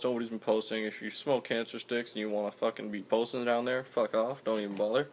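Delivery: usual speaking voice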